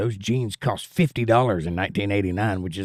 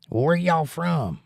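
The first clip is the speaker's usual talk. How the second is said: put-on Southern accent; 6 semitones higher, slower than usual. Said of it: "totally put on Southern accent".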